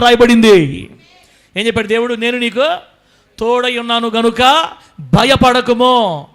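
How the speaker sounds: loud, high, slow proclamation; impassioned loud sermon climax; preaching at full force. Acted. Preaching Bible quote loudly.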